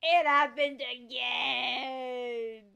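melodramatic wail, slow and loud; mock wailing. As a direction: wail it like a tragic hero. Mock grief over dead pets.

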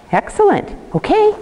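squeaky high praise for a good down; high excited praise. Real, not acted. Praising the dog.

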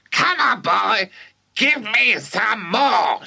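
high, slow screechy demand; demanding yell. Acted. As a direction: bark for the medicine. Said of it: "she yelled".

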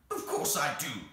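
loud, emphatic creature retort; cross creature. Acted. The Psammead answers Anthea's question, cross. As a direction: retort crossly, a little high.

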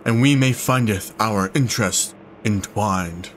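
grand archaic voice. Acted. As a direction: proclaim it like an ancient oracle. Voicing archaic NPC.

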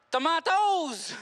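slow, drawn-out English word Tomatoes; mock English Tomatoes; English accent. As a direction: say Tomatoes slowly like an English speaker. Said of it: "ils parlent anglais".

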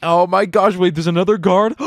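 ordinary talk

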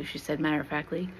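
usual speaking voice

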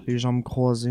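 plain reading voice

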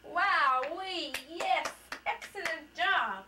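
slow, high, loud cheering; excited praise. Real, not acted. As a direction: cheer like he won. Praising child's success.

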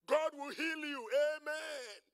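loud, high, drawn-out promise; mock a rousing blessing and amen; prosperity preacher parody.

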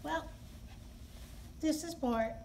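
plain reading voice

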